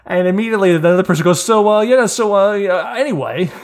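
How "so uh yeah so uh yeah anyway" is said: high, loud, stumbling change of subject; imitated awkward brush-off. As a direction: imitate someone hastily dodging an awkward moment. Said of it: "everyone immediately becomes very uncomfortable".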